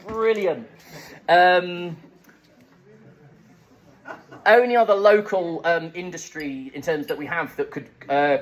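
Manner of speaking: normal talking voice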